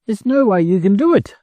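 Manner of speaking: high, worried hen voice; pleading in a high voice; anxious hen. Acted. Frightened hen pleading with duck.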